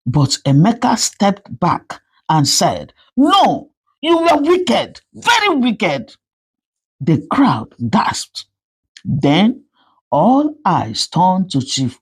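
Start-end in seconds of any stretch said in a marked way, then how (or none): 3.1-6.2 angry rejection. Acted. Son rejects his mother angrily.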